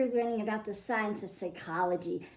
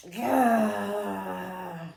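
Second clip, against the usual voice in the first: put-on a playful growl for the kids; mock growl; 3 semitones lower, 4 dB louder than usual. Reading to kids about an angry fish, playful growl. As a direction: growl the mad out, hammed-up. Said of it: "It was a mad, mad".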